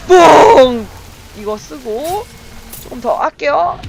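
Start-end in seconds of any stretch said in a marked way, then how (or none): 0.1-0.9 loud, drawn-out "ppong" sound effect; imitated weapon pop. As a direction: burst it out like a comic blast. Imitated weapon noise in game.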